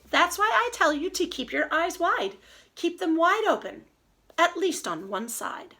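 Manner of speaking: lively storybook read-aloud, slowing on the rhymes; reading to children. Acted. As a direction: savor each silly rhyme for the kids.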